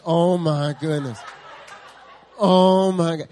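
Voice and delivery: overwhelmed laugh of alarm; laughing disbelief. Retold or imitated. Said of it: "I'm sitting here like".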